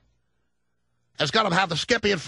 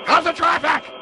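louder, higher call out; 9 semitones higher, 4 dB louder than usual. Acted. Repeated louder.

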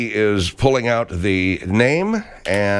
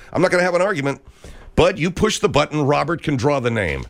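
ordinary talk

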